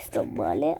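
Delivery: low, breathless whisper, sick with fever; weak feverish whisper. Real, not acted. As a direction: whisper it like you have a fever. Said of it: "ho la febbre".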